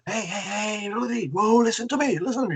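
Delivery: high-pitched, excited mock yelling; retold phone yell. Friend yelling to Rudy on the phone, a high, excited mock yell. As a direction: call out high and urgent, imitating the friend. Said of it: "he was really yelling on the phone".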